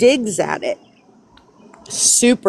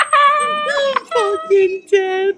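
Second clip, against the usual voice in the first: laughing; much slower than usual. Real, not acted.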